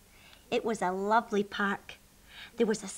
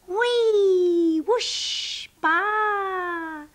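high whee, whoosh and bleat; swinging whoops; 9 semitones higher, 5 dB louder, much slower than usual. Acted. Rabbit and sheep swinging back and forth, whooping high.

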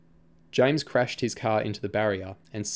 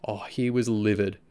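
plain reading voice

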